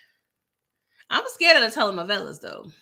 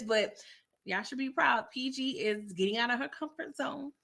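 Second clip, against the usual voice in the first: ordinary talk, nothing marked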